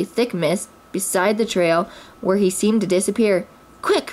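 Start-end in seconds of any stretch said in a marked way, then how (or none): 3.8-4.1 quick high exclamation; eager, high-pitched prompt; eager Rabbit. A narrator's reading. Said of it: "Rabbit said eagerly".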